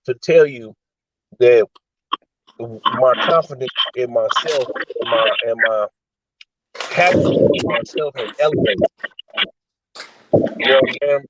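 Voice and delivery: choppy, cutting out phone audio; call dropping out. Real, not acted. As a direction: voice breaking up on the line. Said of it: "you're breaking up".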